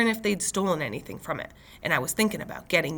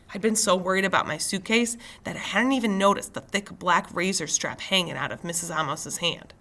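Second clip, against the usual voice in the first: plain reading voice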